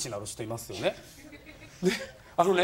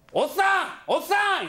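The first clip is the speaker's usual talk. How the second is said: higher calling out; 11 semitones higher, slower than usual. Retold or imitated. Teller calling after the old man, higher. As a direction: call after him down the street.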